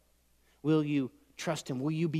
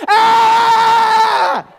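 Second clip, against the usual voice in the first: long, very high full-force scream; piercing drawn-out shriek; panicked scream; 20 dB louder than usual. Acted. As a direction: scream at the top of your lungs. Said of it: "and I did this".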